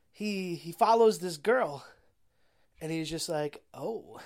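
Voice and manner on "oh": low, soft, ogling "oh"; a low, appreciative murmur; lecherous tire. Retold or imitated. Tire reacting to a girl he spots, lecherous.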